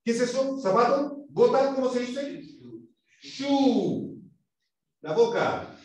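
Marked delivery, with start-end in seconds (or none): 3.2-4.2 SHOE with a long, held "oo"; U larga. Shown as a demonstration. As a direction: round the lips and stretch the vowel in SHOE. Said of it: "Poniendo la boca en posición de U". (SHOE con una U larga, labios en trompita)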